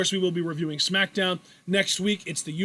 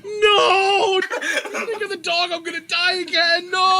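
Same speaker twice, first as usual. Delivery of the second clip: high, whiny cry of hammed-up despair; mock panicked wail; 10 semitones higher, 4 dB louder, slower than usual. Host trying not to die again, mock panicked wail.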